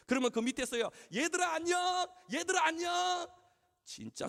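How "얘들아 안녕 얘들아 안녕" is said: high-pitched, sustained holler; calling out greetings. Retold or imitated. Pastor greeting schoolgirls coming downhill, high calling-out. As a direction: hail them brightly from a distance.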